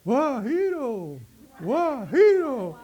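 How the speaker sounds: "guajiro" as a high, loud, slow echo of War Heroes; mishearing War Heroes. Retold or imitated. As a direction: call out "guajiro" stretched like the English cheer. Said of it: "que no sabían inglés". ("guajiro" gritado alto y lento, como eco de War Heroes)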